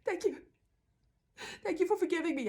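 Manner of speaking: high, relieved mock thank-you; grateful codependent. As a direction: pitch it way up, overly thankful. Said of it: "And the codependent's like".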